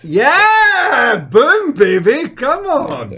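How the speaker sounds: loud high victory whoop; excited cheer. Real, not acted. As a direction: cheer like your pick just won. Cheering [name]'s win.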